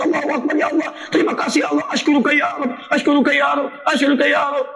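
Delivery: high, thin joyful Allahu Akbar; a blind man who can suddenly see cries out for joy; ecstatic cry. Retold or imitated. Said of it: "Dia teriak".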